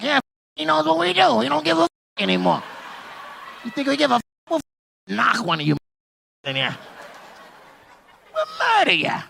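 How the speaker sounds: high, cocky mock-threatening voice; imitating a mobster's woman. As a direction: play her bragging with comic menace. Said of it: "She shook her shoulder like".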